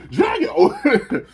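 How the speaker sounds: very high, loud cry of a name; hammed-up yell breaking into a laugh; mock excited shout. Dan seeing Drago has returned, high overexcited shout.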